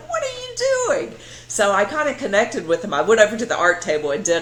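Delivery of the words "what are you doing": puzzled question to the cat. Retold or imitated. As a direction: ask the cat in baffled disbelief. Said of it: "And I was like".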